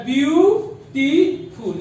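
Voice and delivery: ब्यूटीफुल drawn out slowly as ब्यू, टी, फुल; teacher counting out ब्यू-टी-फुल; syllable by syllable. Shown as a demonstration. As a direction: split ब्यूटीफुल into three stretched syllables. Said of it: "ये तीन syllable का हुआ".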